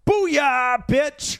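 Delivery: loud, high gloating yell; triumphant shout. Pretend. Host gloating at co-host after banter, triumphant shout. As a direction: crow it like you just won.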